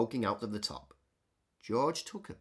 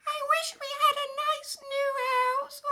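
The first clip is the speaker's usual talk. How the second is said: very high, tiny squeak; squeaky mouse. Acted. Mother Mouse tells George her trouble, high squeak. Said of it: "squeaked Mother Mouse".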